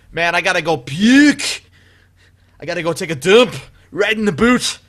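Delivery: loud imitation of a Scottish drunk; drunk switching into Scottish. Man imitating his drunk buddy Keith, loud Scottish accent. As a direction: slide from English into a thick Scottish accent. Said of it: "from slurry English to perfect Scottish".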